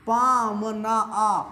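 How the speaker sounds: पामना very slow, the closing आ held; खींचकर उच्चारण. Shown as a demonstration. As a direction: stretch पामना syllable by syllable into a separate आ. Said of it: "जब उच्चारण में आ आए". (पामना को धीरे खींचकर, अंत में लंबा आ)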